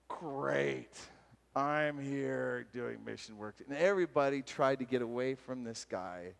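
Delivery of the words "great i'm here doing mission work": low, slow, dragged-out grumble; acted weary, sarcastic complaint; glum sarcasm. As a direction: drag it out with mock resignation. Acted disgust at mission work.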